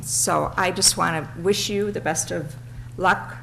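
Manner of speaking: normal talking voice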